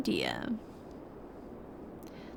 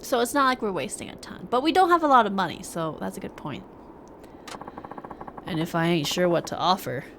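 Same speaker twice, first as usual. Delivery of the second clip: ordinary talk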